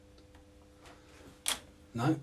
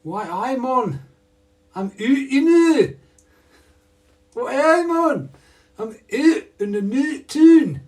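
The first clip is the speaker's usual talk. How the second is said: loud high outburst; 12 semitones higher, 14 dB louder than usual. Acted. Loud singing-style outburst while working.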